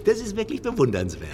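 high, breaking into laughter mid-line; laughing. Acted. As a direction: laugh through the praise, amused. Laughs while praising self-irony.

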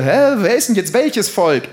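high-pitched baffled protest; exasperated outburst. Acted. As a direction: build from confusion to a loud, high claim. Acted exasperated protest over identity. (hoch aufgebrachter Ausruf)

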